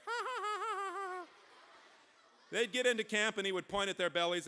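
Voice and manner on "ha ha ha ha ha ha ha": put-on very high baby giggle; baby laugh. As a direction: squeal out a rapid baby "ha-ha". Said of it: "he would just laugh at the bear".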